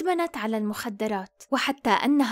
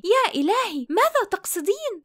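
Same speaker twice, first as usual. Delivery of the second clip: higher-pitched, mildly alarmed question; startled dismay; 6 semitones higher than usual. A narrator's reading. Melanie answering her shouting daughter in the café, taken aback. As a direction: exclaim in disbelief, pitch lifted.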